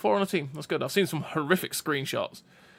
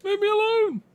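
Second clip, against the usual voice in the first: very high, loud mock wail; hammed-up high protest from a picked-on victim; put-upon Rock's voice; 10 dB louder than usual. Rock just sitting there, answering the screaming tank, mock-wounded wail. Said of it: "it's just sitting there going".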